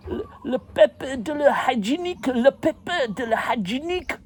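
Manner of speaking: high-pitched pleading in bad French; botched French, sung out high; mock French. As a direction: call out in hammed-up French. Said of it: "which is toilet paper in French".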